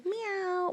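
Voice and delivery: high, soft kitty meow. Acted. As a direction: mew like a kitten for a child. Echoing the toddler's animal sound.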